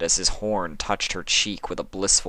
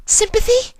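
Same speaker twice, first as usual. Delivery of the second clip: very high, loud calling voice; Fluttershy calling; 8 dB louder than usual. Acted. Fluttershy calling for Sympathy, very high and loud. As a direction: call into the house. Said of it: "she called".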